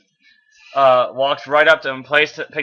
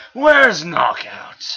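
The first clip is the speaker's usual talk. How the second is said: corrected voice; 4 semitones higher, 3 dB louder, much slower than usual. Acted. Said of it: "I thought that was Bumblebee".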